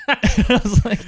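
a high laugh breaking over I was like; high burst of laughter. Real, not acted. Laughing at teen Danny's line, high and slow.

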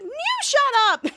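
put-on high voice, hammed-up; mock squealed comeback. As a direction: top him with a high, stretched-out shriek. Daughter tops dad's exclamation.

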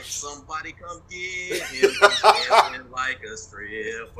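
slow, laughing jeer in a put-on voice; mock bystander laughing. To the host, imagining onlookers mocking his shaking: slow laughing jeer. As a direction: tease him through laughter. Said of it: "somebody could have been like".

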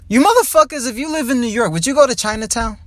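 calling out. Real, not acted. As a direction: shout it out to the viewers. Calls out to the audience.

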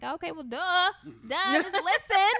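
loud, high retold "told you so"; hammed-up exasperated reply, pitched high and loud; mock exasperation. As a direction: play up being fed up at finally being heard. Retelling her exasperated reply to husband.

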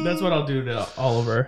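ordinary delivery

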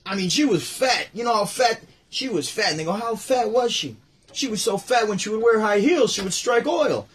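high, loud comedian's punchline delivery; doing Rodney on stage; Rodney Dangerfield bit. Retold or imitated. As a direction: belt the fat jokes like a nightclub comic. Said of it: "he was like".

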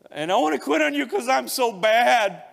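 high, loud, breaking cry to God; desperate plea. Retold or imitated. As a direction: plead with everything, pitched high and loud. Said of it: "Here's what, here was the".

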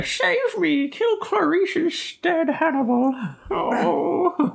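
very high strained pleading voice; squeaky mutant begging for help, hammed-up; weird movie mutant. Imitating the mutant from a horror movie for a friend, weird pleading. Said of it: "weird fucking, like, mutant thing that was in Hannibal".

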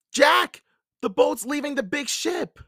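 very high cry, loud on the name; alarmed call. A narrator's reading. Annie warns her distracted companion of pirates, alarmed. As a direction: call out the danger at high pitch. Annie's warning grows more urgent.

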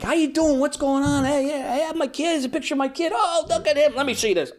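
put-on bubbling over at kid pictures; excited reunion chatter. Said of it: "And we like".

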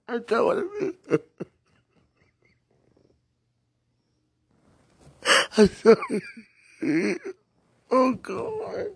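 half laugh, half sob; laughing through tears. Real, not acted. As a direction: crack into laughter while crying.

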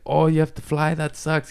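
high imitation of a slight German accent. Touring DJ to people with morning flights, imitated German accent. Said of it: "he has a slight German accent".

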